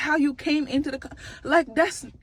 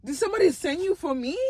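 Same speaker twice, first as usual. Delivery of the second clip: higher-pitched mock African accent; joking, African-accented question; playful African accent; 4 semitones higher than usual. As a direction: ask suspiciously in a put-on African accent. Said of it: "'cause she African, like I said".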